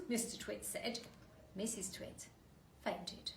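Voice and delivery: ordinary delivery, nothing marked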